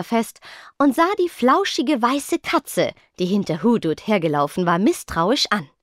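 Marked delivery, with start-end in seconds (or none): none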